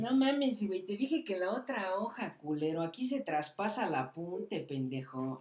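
laughing high scolding that fades to plain; mock angry retort. Replaying the schoolyard fight, he scolds his friend, hammed-up annoyance. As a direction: snap back at him like a bratty teen.

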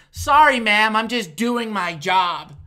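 loud, slow mock-polite apology; sarcastic customer-service sweetness, said slowly. Cashier mocking an angry customer, sarcastic and loud.

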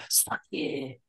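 breathy whispered mock taunt. Friend whispering a taunt at Chris, whispered. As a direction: hiss the taunt under your breath.

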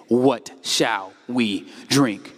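very slow, low, sulky grumble; grumbling complaint. Shown as a demonstration. Preacher acting the grumbling crowd, low and slow. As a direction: moan the words out like a complainer. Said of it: "It says the people grumbled".

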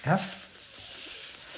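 sustained unvoiced F; F held as a long soft hiss. Shown as a demonstration. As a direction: breathe out a long quiet F. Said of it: "top teeth on your bottom lip".

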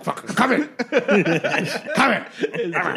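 high, hammed-up drunken shouting; drunk man's holler. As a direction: holler it like the joke's drunk client. Drunk man answers door in joke.

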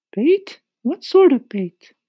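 very high loud curious voice; high squeaky question. A narrator's reading. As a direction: ask it sharply, very high. Insects ask eagerly.

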